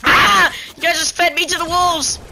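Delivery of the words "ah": high loud startled yell. Real, not acted. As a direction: yelp in alarm. Fed to the wolves.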